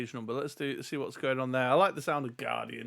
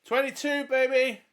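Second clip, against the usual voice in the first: excited; 10 semitones higher, 3 dB louder, slower than usual. Real, not acted. Cheering a lucky roll.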